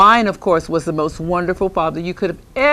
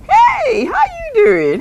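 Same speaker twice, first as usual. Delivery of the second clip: high sing-song greeting; 3 dB louder, slower than usual. Acted. Greeting an invisible ghost.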